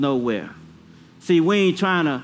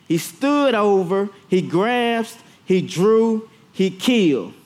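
building preaching; 3 semitones higher, slower than usual. Acted. Preacher at full force before the congregation, climbing. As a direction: build it verb by verb to a peak.

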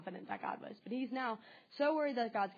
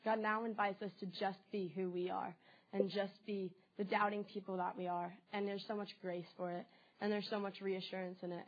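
ordinary talk, nothing marked

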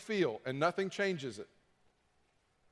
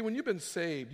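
ordinary delivery

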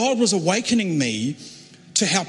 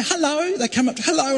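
put-on chirpy girls; 9 semitones higher than usual. Imitating cheery camp girls.

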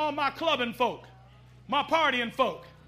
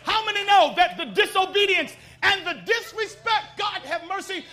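ordinary delivery

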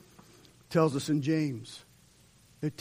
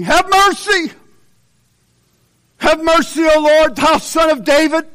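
much higher, louder desperate cry; crying out for mercy; 12 semitones higher, 15 dB louder than usual. Retold or imitated. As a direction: shout it like a blind beggar begging Jesus. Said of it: "they cried out saying".

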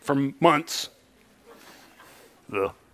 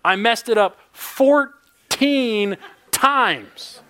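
fourteen drawn out slowly; slow, stunned emphasis on fourteen; slow exasperated; 5 semitones higher, much slower than usual. Real, not acted. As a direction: stretch the words in disbelief. Dragged out exasperated.

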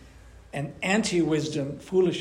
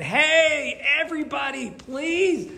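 calling from the rooftops; 8 semitones higher than usual. Retold or imitated. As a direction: call out over the street to everyone. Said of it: "she shouts, like, from the rooftops".

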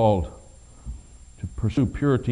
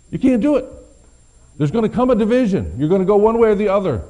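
preaching louder and higher, pushing fast at the end; rising preaching; 9 semitones higher, 6 dB louder than usual. Acted. Preacher to congregation, fervent preaching. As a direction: press the congregation harder with every line.

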